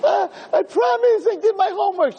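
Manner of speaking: put-on high, whiny child's voice, pleading; pleading little kid. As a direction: beg like a terrified little boy. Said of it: "he's a little kid, we're little teeny kids".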